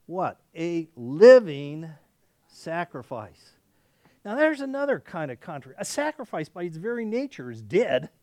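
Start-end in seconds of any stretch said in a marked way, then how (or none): 0.6-3.5 loud on "A living", then drawn out slow; slow emphatic punch. Acted. Preacher driving home Paul's words to the congregation, slow and emphatic. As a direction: stretch "A living sacrifice" slowly, hitting "living" loud. Answers own "what?" from scripture.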